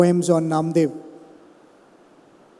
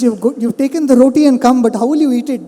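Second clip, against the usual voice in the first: higher and louder, fast and playful; excited teasing; 5 semitones higher, 6 dB louder, faster than usual. Acted. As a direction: tease him fast and bright. Saint teasing dog-god with butter.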